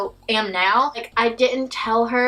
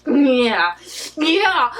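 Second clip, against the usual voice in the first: gritted teeth; 3 dB louder than usual. Retold or imitated. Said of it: "freaking gritting his teeth".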